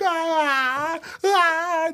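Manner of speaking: a high hooting laugh, held out; imitated Jello laugh. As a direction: mimic a wild high laugh. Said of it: "that Jello laugh".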